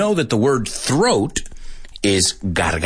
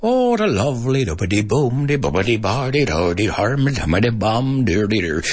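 sing-song mock Irish accent; rhythmic, musical Irish-accented babble; Irish lilt; 2 semitones higher than usual. Teacher showing sing-song Irish cadence, lilting Irish accent. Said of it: "Is Irish".

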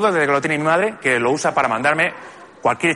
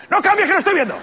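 high, loud groggy protest from a napping dad; dad startled awake, voice jumping high; groggy father; 5 dB louder, faster than usual. Retold or imitated. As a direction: squeak it out like a half-asleep father caught dozing. Snoring father wakes, protests.

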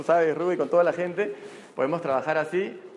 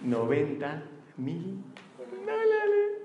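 pitched way up in mock amazement; amazed; 2 semitones higher, 4 dB quieter, much slower than usual. Reveals the prize amount.

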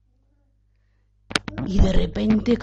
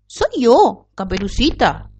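put-on wolf faking girl's voice; 4 semitones higher, 4 dB louder, slower than usual. A narrator's reading. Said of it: "fingió el lobo la voz de Caperucita".

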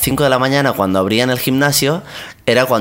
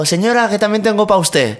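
put-on cheeky young man; 10 semitones higher than usual. Retold cheeky young man's line.

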